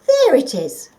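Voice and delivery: muttering while searching boxes; rummaging grandpa. Acted. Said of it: "he said".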